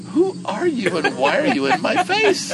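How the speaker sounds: high, loud, slow startled voice; startled Raj. Retold or imitated. Raj asking the biker who he is, startled and high. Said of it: "And Raj looks at him and goes".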